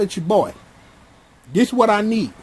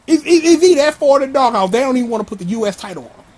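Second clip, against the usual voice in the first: loud, fast, higher-pitched questioning; worked-up disbelief; 5 semitones higher, 5 dB louder, faster than usual. Real, not acted. Fan questioning the writers' booking again, loud incredulity.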